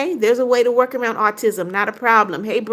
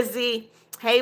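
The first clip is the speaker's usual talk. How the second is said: ordinary talk, nothing marked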